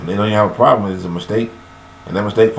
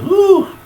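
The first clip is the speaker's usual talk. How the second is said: high whoop; 4 dB louder than usual. Real, not acted. Preacher exclaims mid-rant.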